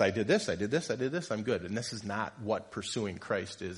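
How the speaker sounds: normal talking voice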